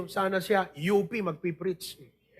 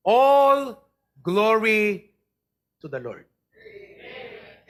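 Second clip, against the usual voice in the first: praise call; 5 dB louder, much slower than usual. Real, not acted. Preacher leads praise shout.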